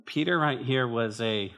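usual speaking voice

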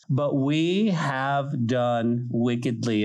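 "we" leaned on, whole line slow; stress on we. Shown as a demonstration. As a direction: dwell on "we" and drag the line out. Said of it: "underline the word "we".

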